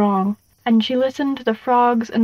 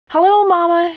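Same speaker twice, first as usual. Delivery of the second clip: raised, higher-pitched call into the house; 7 semitones higher, 6 dB louder than usual. A narrator's reading. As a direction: call out a bright hello to mama. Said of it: "she called".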